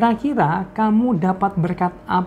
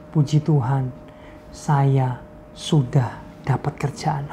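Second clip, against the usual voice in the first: slow hushed whisper; 2 semitones lower, 3 dB quieter, slower than usual. Retold or imitated. Friend tells the pastor about the new job, slow whisper. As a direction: breathe the good news out slowly. Friend reports good news.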